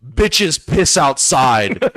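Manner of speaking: slow cocky put-down; slow, deliberate punchline. Acted. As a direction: drag out each word with swagger. Punchline said over the fence.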